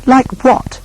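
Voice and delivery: high, eager question; curious younger brother. Acted. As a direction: squeak out the question. Second son asks, much higher.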